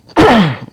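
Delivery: strained, coughing throat clear. Real, not acted. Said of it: "I can speak again".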